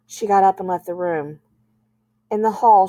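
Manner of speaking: ordinary delivery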